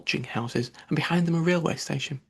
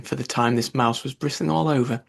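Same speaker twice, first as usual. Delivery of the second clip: usual speaking voice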